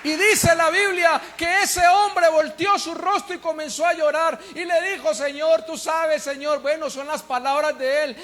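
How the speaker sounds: thundering sermon climax; full-force preaching. Acted. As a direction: proclaim it to the back of the church.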